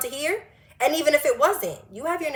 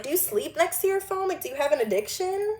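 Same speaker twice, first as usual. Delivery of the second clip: mocking nosy coworker; 6 semitones higher, 3 dB quieter than usual.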